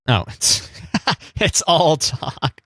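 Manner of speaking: laughing. Real, not acted. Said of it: "laughs, slow echo".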